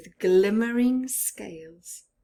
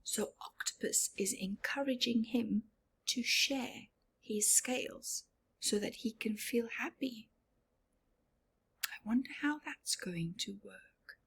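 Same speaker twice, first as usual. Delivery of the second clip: hushed storyteller aside; whispered aside; 10 dB quieter than usual. Real, not acted.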